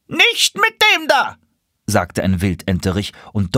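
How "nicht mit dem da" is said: very high, squawking duck protest; objecting drake. Acted.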